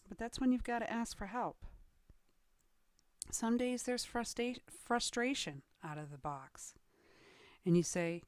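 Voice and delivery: usual speaking voice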